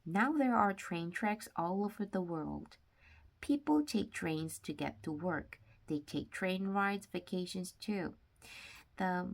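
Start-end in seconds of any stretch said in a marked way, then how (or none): none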